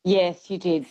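normal talking voice

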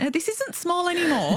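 sudden high-pitched dawning alarm; alarmed realization. Retold or imitated. Recalling her near-death thoughts to the interviewer, high alarmed realization. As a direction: voice jumps up as the truth lands.

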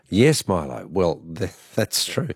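normal talking voice

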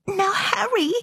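high, timid little plea. A narrator's reading. Frightened mother trying to calm her husband, timid. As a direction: squeak it nervously. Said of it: "said the mother timidly".

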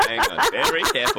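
high squeaky laughing voice; words squeezed out through laughter. Real, not acted. Both laughing at the mix-up.

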